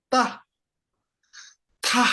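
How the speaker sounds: Ta said high and short, then repeated; syllable drill. Shown as a demonstration. Teacher to class, modelling the sound. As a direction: model Ta crisply for students. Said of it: "I will read them one by one".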